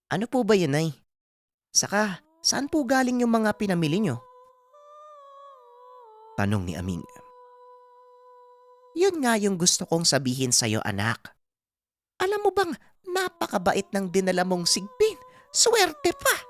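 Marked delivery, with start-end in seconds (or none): 0.1-4.2 put-on higher-pitched, curious young son; respectful son questioning his mother; Aming. 8.9-16.4 put-on delighted old mother, pitched high; mother gushing to her son, climbing higher as she goes; Aling Pasing.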